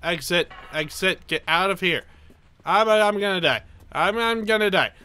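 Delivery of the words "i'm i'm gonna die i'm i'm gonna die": panicked. Real, not acted. Fleeing explosion in panic.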